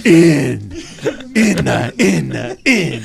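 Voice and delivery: loud, high chanted syllables; weird voice. Acted. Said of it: "saying it in a weird voice".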